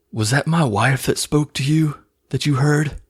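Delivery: overacted emotional movie line; mock movie melodrama. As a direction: plead it like a bad actor. Acts out Bradley Cooper's emotional question.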